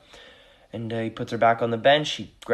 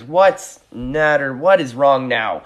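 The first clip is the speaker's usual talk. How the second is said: slow and louder, losing patience; annoyed; 3 semitones higher, 9 dB louder, much slower than usual. Acted. Fisherman snapping at the crying lady, slow annoyed shout. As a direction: draw it out, fed up with her crying. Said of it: "And now he's mad".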